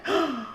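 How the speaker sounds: short, high gasp; sudden gasp. Real, not acted. Reacting to a game discovery.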